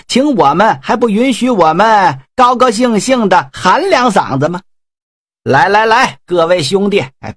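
high, jeering, with mock laughter; rowdy generals mocking him over wine; taunting drunken general. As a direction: needle your grieving elder brother. Generals taunting grieving Huang.

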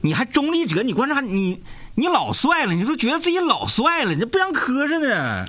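mocking tease. Real, not acted. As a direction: poke fun at his smugness, voice up high. Co-host mocks caller's self-image. (调侃讽刺，音调抬高)

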